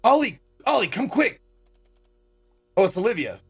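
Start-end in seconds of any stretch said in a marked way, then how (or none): 0.0-1.4 calling out. Acted. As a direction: call her over in a hurry. Kid calling out urgently.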